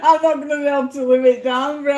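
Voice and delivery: giggling as she teases; laughing. Real, not acted. Teasing Brian that he won't live it down, laughing.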